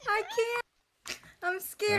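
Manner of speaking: high, frightened little cry; real panic under attack; scared. Real, not acted. Attacked by villagers in game.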